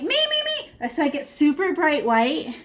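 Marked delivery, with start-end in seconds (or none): 0.0-0.9 very high little "me" cries; squeaky eager volunteer. Acted. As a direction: pipe up eagerly, far above normal pitch.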